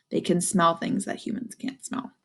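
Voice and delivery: ordinary delivery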